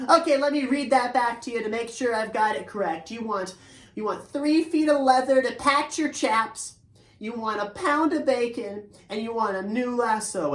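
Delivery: eager to get it right; eager horse voice. Acted. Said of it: "you can know that Tempo is talking".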